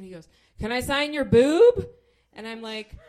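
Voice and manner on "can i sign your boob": sudden loud, high blurt; booming eager outburst; snapping awake. Retold or imitated. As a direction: burst out as if jolted awake. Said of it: "he sort of snaps to it".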